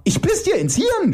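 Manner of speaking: very high screeched threat; screaming. Acted. Iris threatening her opponent, screaming. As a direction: shriek it at the top of your range. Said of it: "schrie Iris".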